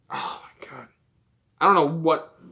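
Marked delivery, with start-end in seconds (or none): 0.1-0.9 whispered disbelief, very soft; exasperated whisper. Real, not acted. Ranter despairing over the boss's opinion, exasperated whisper. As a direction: breathe it out under your breath. Exasperated aside after long pause.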